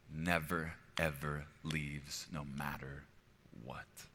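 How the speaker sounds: low, soft, slow promise; hushed reassurance. Real, not acted. Preacher drops voice for reassurance.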